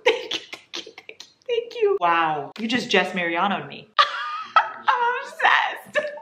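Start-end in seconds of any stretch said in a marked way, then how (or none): 0.0-2.0 breathy laugh rising into high squealed thanks; gushing, giggling gratitude. Real, not acted. Overjoyed thanks for gift. 4.9-6.1 high, laughing half-whisper ending in a gasp; giddy squeal of delight. Real, not acted. Overwhelmed by gift.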